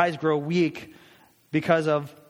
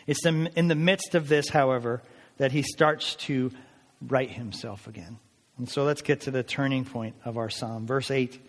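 ordinary delivery

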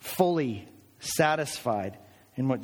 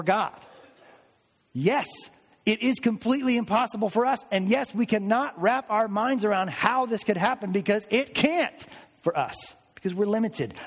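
fervent preaching; 6 semitones higher than usual. Acted. Preaching at full force.

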